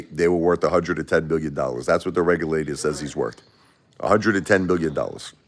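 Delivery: ordinary delivery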